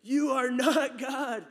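slow, high, loud battle cry; big epic warrior shout; warrior's cry. Retold or imitated. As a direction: bellow it like a movie warrior hurling a spear. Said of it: "this warrior runs up and he goes".

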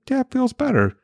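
put-on very high little-kid voice; child answering parent. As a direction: imitate a small daughter's reply. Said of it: "She's like".

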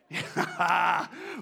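real joyful laugh; burst of laughter. Real, not acted. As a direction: laugh out with joy. Laugh after sermon peak.

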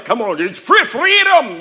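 high, loud, hammed-up Braveheart accent; mock Braveheart cry. Preacher clowning as Braveheart for the church, mock battle cry in bad accent. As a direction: bellow a badly faked Braveheart battle cry. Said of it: "He could have done something like Braveheart".

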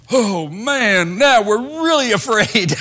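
high, laughing mock of scared disciples; mock fearful disciples. Preacher mocking the frightened disciples to the congregation, high and laughing. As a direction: send up their silly answer.

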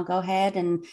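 usual speaking voice

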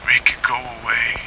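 put-on frightened boy pleading to his mother; sobbing whisper. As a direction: beg through tears under your breath. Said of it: "he sobbed".

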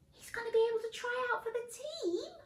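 high, fast amazed question; amazed gasp. Acted. Reader amazed that Dan can try out, sounding high. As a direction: gasp with disbelief.

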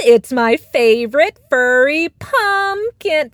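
high, loud, drawn-out cooing; gushing cow. A narrator's reading. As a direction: coo over a pet, stretching the words. Cow gushes over the cat.

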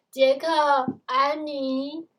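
loud mother's call from afar. A narrator's reading. Mother calling Jack and Annie home from afar, calling out. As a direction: call the children's names out loudly. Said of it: "远方又传来妈妈的叫声".

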